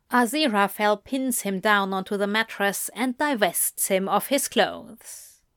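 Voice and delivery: ordinary delivery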